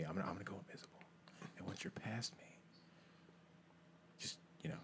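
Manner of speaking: secretive, voiceless whisper; whispered instructions. Acted. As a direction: whisper the plan to your pet owl. Secret instructions to his owl.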